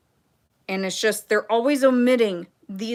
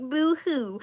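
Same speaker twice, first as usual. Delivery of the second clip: slow, lightly mocking boo-hoo; mock crying; 6 semitones higher than usual. Mocking people crying over Netflix money, light mock sob. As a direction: sneer at their whining. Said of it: "crying about their Netflix money".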